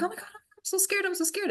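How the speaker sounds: high, hushed mock panic; quick soft high-pitched retold fright; imitated fear. Retelling a frightened client's reaction in hypnosis, panicky. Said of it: "she got an emotional response".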